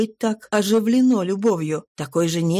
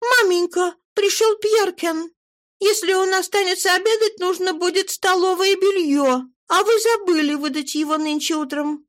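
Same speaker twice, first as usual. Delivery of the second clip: put-on much higher girlish voice, a little hurried; young daughter's voice; 10 semitones higher than usual. A narrator's reading. Daughter tells mother a guest has come, high girlish voice. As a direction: announce the guest like a dutiful girl.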